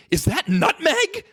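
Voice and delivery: high, slow, panicky squeak; mock alarm at the nutmeg; panicked question. Friend panicking over too much nutmeg, high panicked squeak. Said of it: "like, with a panic".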